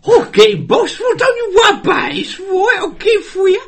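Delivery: very high, loud cartoon sidekick squawk; goofy henchman. Henchman reassuring his boss, goofy high shouting. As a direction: squeal it like an over-eager henchman. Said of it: "one of the goofy henchmen".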